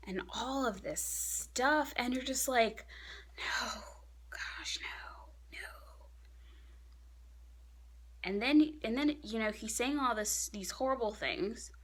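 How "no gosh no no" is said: fading into a soft whisper; whispered dismay. Retold or imitated. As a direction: let the refusal die away to breath. Acts out reaction to cruel words.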